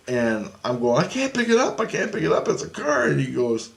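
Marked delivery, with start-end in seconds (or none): 1.0-3.1 panicked protest. Retold or imitated. Pleading in dream story.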